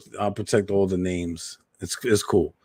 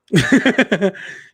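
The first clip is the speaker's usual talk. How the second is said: high, rapid real laughter; giggling run of laughter; amused laugh; 11 semitones higher, 4 dB louder than usual. Real, not acted. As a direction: laugh at your own comeback. Laughs at own comeback.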